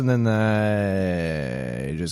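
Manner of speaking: very slow, trailing drone; pilot voice. Shown as a demonstration. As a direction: stretch the last word out like a rambling pilot. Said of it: "when I say pilot voice".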